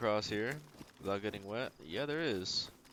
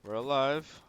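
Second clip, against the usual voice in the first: relieved cheer; 4 semitones higher, 8 dB louder, slower than usual. Real, not acted. Relieved after reaching town.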